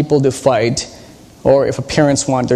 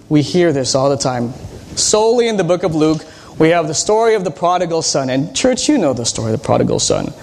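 ordinary talk

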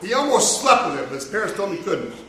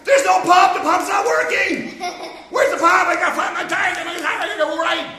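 put-on a kid in a rush shouting about his tire; frantic yelling kid; 8 semitones higher than usual. Boy frantic about pump.

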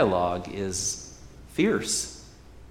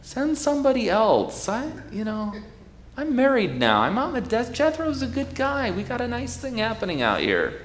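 much higher, pleading excuses; whiny reluctant Moses; 13 semitones higher than usual. Acted. Moses begging God to send someone else, high and whiny. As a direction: squirm out of the job, whining.

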